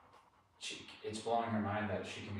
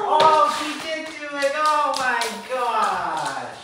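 much higher and louder, slow amazed gush; thrilled exclamation; 14 dB louder, much slower than usual. Real, not acted. Trainer marvels that Maggie did it. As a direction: gush with delight. Dog succeeded.

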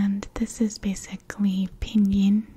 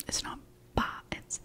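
normal talking voice

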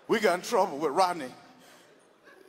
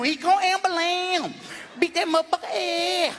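brother impression; 8 semitones higher than usual. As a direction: crow it high like the brother. Brother voiced boasting.

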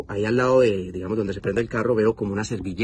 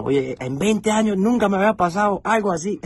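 put-on much higher, playful Cuban cop accent; 10 semitones higher, 3 dB louder than usual. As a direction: imitate the Cuban officer's Cuban accent. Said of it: "porque él es cubano". (acento cubano del policía, agudo y divertido)

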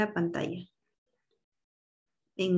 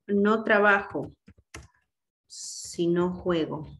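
slow, deliberate example reading; 2 semitones lower, slower than usual. Shown as a demonstration. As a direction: spell out the example word by word. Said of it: "Por ejemplo".

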